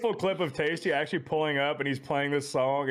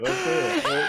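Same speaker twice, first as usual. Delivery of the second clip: excited laughing shout; 3 dB louder, much slower than usual. Real, not acted. Urging friend to show clip.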